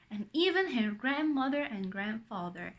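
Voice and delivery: ordinary delivery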